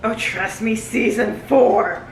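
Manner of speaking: ordinary delivery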